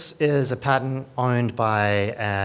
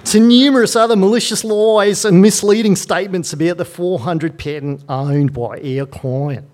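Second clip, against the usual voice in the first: hammed-up Australian lawyer voice, much higher and louder; mock Australian accent; 10 semitones higher, 11 dB louder than usual. Shown as a demonstration. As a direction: read it like an over-the-top Australian solicitor. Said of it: "I'll read it in my best Australian accent".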